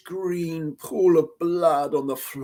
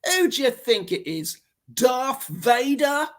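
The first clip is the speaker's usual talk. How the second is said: higher, sneering and slowed on Darth Vader; bratty snarl; 8 semitones higher than usual. Acted. The brat snarling a reply through the door. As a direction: snarl it back like a sarcastic brat. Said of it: "snarled the nasty little voice".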